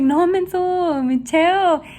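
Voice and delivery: high, drawn-out mock scolding; exasperated correction. Imitating mom correcting her son, exasperated mock scolding. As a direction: set him straight, slow and high.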